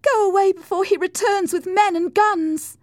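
high, loud warning; urgent cry. A narrator's reading. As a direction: urge him away at full pitch. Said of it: "cried Cathy".

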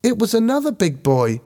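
put-on high-pitched boy's voice; young boy. A narrator's reading. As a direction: answer as a schoolboy, pitched high. Young boy Ben voiced in story.

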